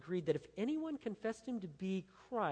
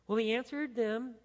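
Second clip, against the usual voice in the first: ordinary talk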